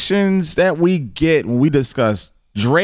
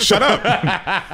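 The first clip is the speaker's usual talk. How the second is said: higher-pitched, teeth-clenched retort; through clenched teeth; 7 semitones higher than usual. Real, not acted. Co-host cutting off another argument, through clenched teeth. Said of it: "Through clenched teeth".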